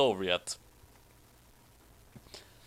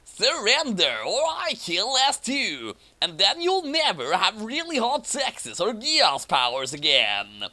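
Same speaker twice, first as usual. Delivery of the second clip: villain threat; 6 semitones higher than usual. A narrator's reading. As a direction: threaten the hero over a hostage. Ron Paul threatening hostage.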